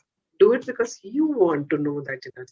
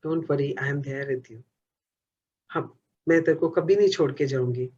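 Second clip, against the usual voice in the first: put-on softer, lower, hushed promise to a child; tender reassurance; 5 semitones lower, 4 dB quieter than usual. Shown as a demonstration. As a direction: speak gently to your inner child. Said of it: "उसको आपने यही बोलना है". (अपने अंदर के बच्चे से कोमल, धीमा वादा)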